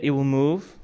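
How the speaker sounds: normal talking voice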